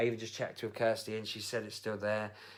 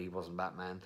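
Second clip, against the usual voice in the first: usual speaking voice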